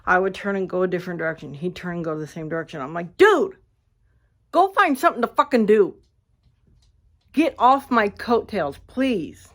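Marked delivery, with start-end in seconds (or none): 3.2-9.5 high, loud exasperated snap; irritated outburst. Retold or imitated. Snapping at the man following her in the pool, loud and exasperated. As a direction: tell him off like a pest you can't shake.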